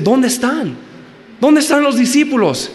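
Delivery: high, bewildered angel voice; puzzled angels. Acted. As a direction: ask like baffled angels looking around.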